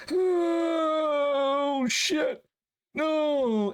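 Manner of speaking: imitating himself crying out after the fall; reliving the moment he fell off the ladder, crying out; pained cry. Falling off ladder in pain.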